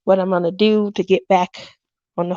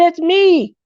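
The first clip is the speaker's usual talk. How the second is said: insistent protest; 7 semitones higher than usual. Retold or imitated. Retells insisting on her identity.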